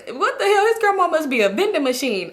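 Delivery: high-pitched, incredulous uncle impersonation; hammed-up ridicule, pitched high; imitating uncle mocking. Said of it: "he started dying laughing".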